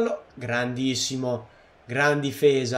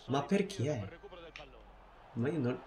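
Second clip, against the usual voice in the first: stunned disbelief; 3 semitones lower, 16 dB quieter than usual. Real, not acted. Disbelief after goal.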